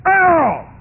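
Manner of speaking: very loud, high shout of a name; calling out loud. Retold or imitated. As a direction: call him out from the front. Calling across the meeting hall.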